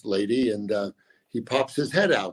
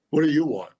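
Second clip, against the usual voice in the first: gruff retold snap; 3 semitones higher, 6 dB louder than usual. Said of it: "Just like that".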